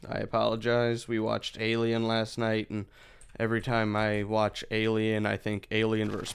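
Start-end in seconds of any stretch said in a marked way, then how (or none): none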